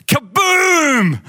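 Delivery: high, loud boom effect; mock kaboom; explosion sound. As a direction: shout the bang like a blast going off. Explosion in the story.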